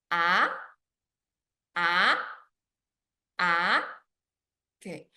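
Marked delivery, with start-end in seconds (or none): 0.1-3.8 "Ah" rising from middle to high; second tone. Shown as a demonstration. Teacher showing learners the second tone, "Ah" climbing to high. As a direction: swing each "Ah" upward like a question. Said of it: "It starts middle and goes up high".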